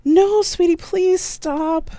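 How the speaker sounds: shouting after the fleeing fiancé as a distraction; yelled plea. A narrator's reading. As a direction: call him back, desperate. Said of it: "as I yelled".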